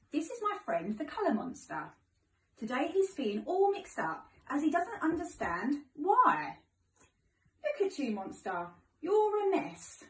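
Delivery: sing-song storybook voice for little ones; reading to children. Acted. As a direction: read it like story time for children. Teacher reads picture book to class.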